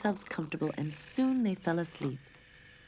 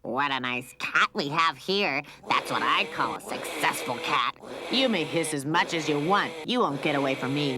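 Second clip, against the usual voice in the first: gloating cat catcher; 5 dB louder than usual. A narrator's reading. Cat catcher gloating over trapped Lucy, mocking. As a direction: savor the catch. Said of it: "the cat catcher laughed".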